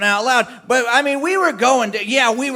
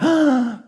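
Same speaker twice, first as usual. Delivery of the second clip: delighted gasp; 5 semitones higher than usual. Retold or imitated. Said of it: "he looked down and he said".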